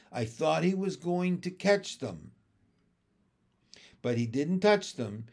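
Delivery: ordinary delivery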